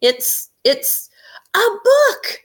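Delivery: put-on high, loud little girl's discovery; surprised child. Amy finding a book in the magician's hat, high delighted child. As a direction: gasp it out like a delighted kid. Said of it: "Amy shouted".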